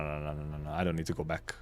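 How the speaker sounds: ordinary talk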